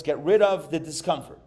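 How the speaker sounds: ordinary talk, nothing marked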